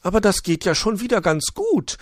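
high magpie voice, full of pity; sympathetic magpie. Acted. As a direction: ask after the hurt wing kindly. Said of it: "freute sich die Elster".

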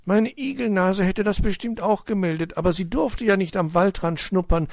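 put-on very high, loud childish protest; indignant grumbling. A narrator's reading. Little hedgehog defending his nose, indignant. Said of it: "murrte Borstel und sah dabei so entrüstet aus".

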